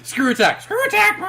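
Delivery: high excited call-out; excited shout. Real, not acted. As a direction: blurt it out with glee. Calling out a team name.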